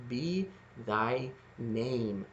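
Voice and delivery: slow, soft, each word drawn out; thy and name drawn out to rhyme; exaggerated rhyme. Shown as a demonstration. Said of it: "we wouldn't say it like that".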